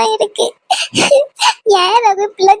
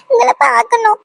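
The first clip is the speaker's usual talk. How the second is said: tearful pleading; sobbing plea to her lover; crying plea; 2 semitones higher than usual. Acted. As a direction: beg through tears. Anu pleading with Raghu.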